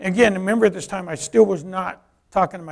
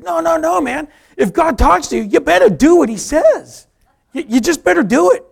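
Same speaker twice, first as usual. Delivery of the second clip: high, loud acted insistence; insistent urging; 11 semitones higher, 11 dB louder than usual. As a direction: press him hard, voice way up.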